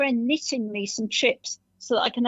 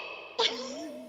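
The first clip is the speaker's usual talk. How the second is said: high laugh; 10 dB quieter than usual. Real, not acted. Laughs after own Punch voice.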